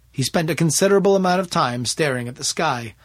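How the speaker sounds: plain reading voice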